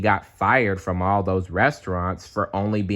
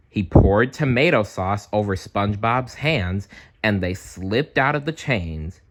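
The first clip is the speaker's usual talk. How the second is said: plain reading voice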